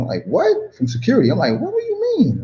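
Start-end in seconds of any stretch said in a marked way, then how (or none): 0.3-1.3 high-pitched retold "what?" of disbelief; mock disbelief, pitch jumping up; incredulous. Traveller to gate attendant over a missing stamp, incredulous. Acts out his disbelief at counter. 1.6-2.4 high-pitched mock protest; incredulous. Traveller told he cannot board the plane, incredulous.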